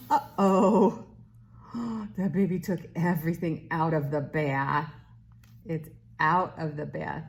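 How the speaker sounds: bright sing-song read-aloud voice; reading to a toddler. Acted. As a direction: read it like to a little one. Reads a picture book to baby.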